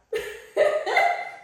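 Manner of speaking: short airy laugh; breathy laugh. Real, not acted. Vlogger laughing after scolding her viewers.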